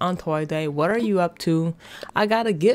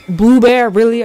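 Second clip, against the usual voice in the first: loud, incredulous complaint; hammed-up disbelief; mock outrage; 3 semitones higher, 10 dB louder than usual. Real, not acted. Gamer scolds the villager who took his spot, loud and incredulous. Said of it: "I am furious".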